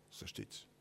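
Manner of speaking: breathy whisper, almost voiceless; whispered aside. Real, not acted. Preacher whispers a warning to the congregation. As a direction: lean in and whisper it to them.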